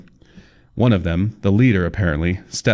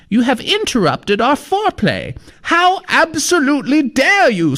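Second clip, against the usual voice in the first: put-on very high scolding old woman; prim old lady. Old lady scolding Kyle in a very high prim voice. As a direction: scold him like an offended granny.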